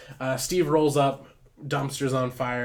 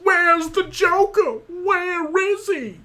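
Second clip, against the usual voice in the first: much higher, louder, slow hammed-up Batman; a silly over-the-top Batman growl pitched way up; 8 dB louder, slower than usual. Batman arriving at the dumpster fire, hammed-up silly voice. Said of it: "so I can do my Batman voice".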